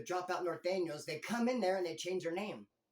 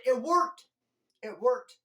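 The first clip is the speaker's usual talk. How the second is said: triumphant; 6 semitones higher, 10 dB louder, slower than usual. Real, not acted. Triumphant punchline of the prank.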